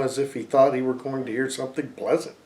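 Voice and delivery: usual speaking voice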